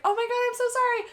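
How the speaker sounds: high, squeaky apologetic voice; mock apology. Runner apologizing to her sister, high mock gush. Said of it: "I was like".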